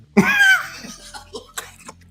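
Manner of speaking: a shrieking burst of laughter; squealing laugh at her own joke; loud high laugh. Real, not acted. Laughs after her punchline.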